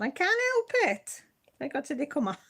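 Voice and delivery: high, playful quoted comeback; own cheeky reply. Retold or imitated. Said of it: "I said".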